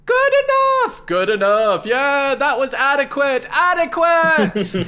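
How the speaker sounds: high, loud lukewarm cheering; crowd shouting. Acted. The crowd tosses a little silver at Flea, high loud shouts. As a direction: shout it like a crowd that is barely pleased. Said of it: "the crowd throw only a couple ... and say".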